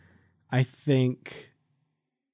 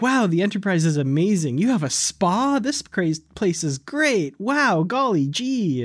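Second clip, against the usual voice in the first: higher, swooping gee-whiz gushing; mock aw-shucks wonder; 5 semitones higher than usual. Imitating the counselor marveling at the Enterprise, aw-shucks gushing. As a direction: gush like a wide-eyed newcomer, hammed up. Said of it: "seemed a little bit aw shucks".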